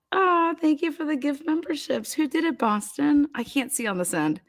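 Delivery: usual speaking voice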